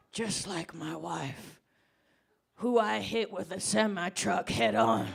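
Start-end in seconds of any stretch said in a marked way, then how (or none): none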